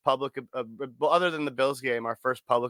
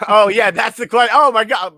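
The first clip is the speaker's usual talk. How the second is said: loud, high oh my God as it dawns on him; sudden realization; 9 semitones higher, 10 dB louder than usual. Real, not acted. Host realising he got carried away, loud and high. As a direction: blurt it out as it hits you.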